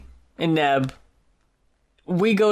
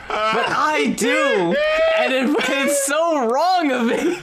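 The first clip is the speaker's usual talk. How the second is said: laughing; 8 semitones higher than usual. Real, not acted. Laughing admission of guilt.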